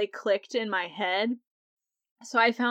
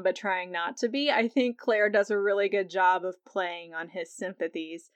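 ordinary talk, nothing marked

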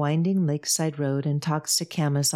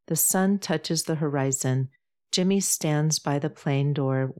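ordinary delivery

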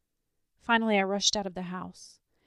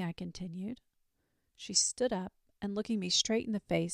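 ordinary delivery

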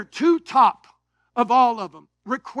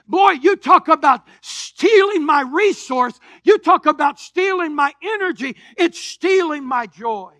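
raised, driving preacher voice; fervent preaching; 4 semitones higher, 6 dB louder than usual. Acted. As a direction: preach it out to the congregation. Preaches about debt, building force.

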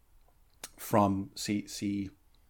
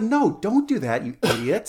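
imitated exasperated objection; 7 semitones higher, 3 dB louder than usual. Acting out Everest's objection.

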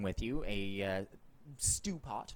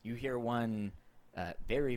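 ordinary talk

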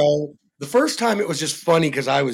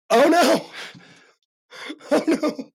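laughing oh no; 9 semitones higher than usual. Real, not acted. Laughing panic.

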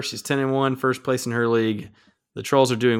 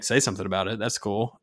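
ordinary talk, nothing marked

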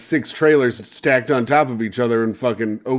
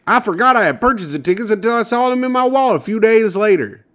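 Michigan lottery winner impression; 8 semitones higher, 3 dB louder than usual. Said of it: "I'll do my impression of what I think he sounds like".

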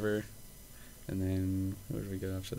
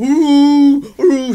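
high, loud, drawn-out mock howling "ooh"; old man's howl; 17 dB louder than usual. Retelling the old man's noise at the bar, loud mock howl. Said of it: "he just started going".

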